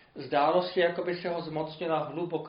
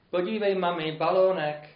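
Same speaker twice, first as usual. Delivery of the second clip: louder, raised call to mum; child's excited cry; 3 semitones higher, 7 dB louder than usual. A narrator's reading. Child pointing out a balloon to mum, calling out. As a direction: read as a child calling out, mildly exclaimed. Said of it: "ukázalo prstem a vykřiklo".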